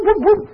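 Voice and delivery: high quick whoop whoop; mock alarm whoop. Preacher voices miners fleeing the gas, high mock whoop. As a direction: yelp it like a miner bolting.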